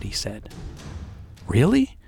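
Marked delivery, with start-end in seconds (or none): 1.5-2.0 bright, delighted little question; light up with joy asking it; happy excited. Retold or imitated. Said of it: "so happy".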